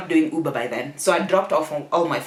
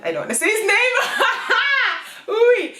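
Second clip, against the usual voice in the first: high-pitched words bursting into laughter; real squealing laugh as you speak. Real, not acted. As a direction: crack up mid-sentence. Breaks up over nearly naming ex.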